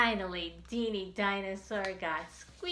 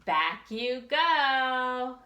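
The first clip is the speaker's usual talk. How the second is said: slow, drawn-out back you go; slow refrain; 4 semitones higher, 4 dB louder, much slower than usual. Acted. Narrator sending Deanie back to the tub, slow. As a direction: stretch each word.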